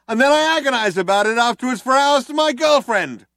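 earnest cop on a hailer. A narrator's reading. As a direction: lecture them like a wounded cop. Cop's long speech.

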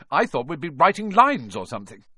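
"lines" whined high and fast; spoiled pitch on "lines"; whiny lines. Acted. Malfoy whines.

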